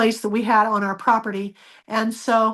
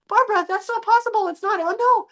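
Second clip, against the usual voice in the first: alarmed husband; 11 semitones higher, faster than usual. Retold or imitated.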